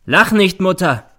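loud, high sharp command; imperious rebuke. A narrator's reading. Son rebukes his laughing mother, commanding. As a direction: order her to stop. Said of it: "herrscht sie der Sohn an".

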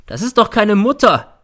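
high, loud shout. A narrator's reading. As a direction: yell it out in rage. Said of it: "schreit er".

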